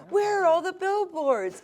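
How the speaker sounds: high-pitched mock dismay; high, playfully panicked protest; retold alarmed outcry. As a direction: wail it as an imitated worried demand. Retold dismayed question to producer.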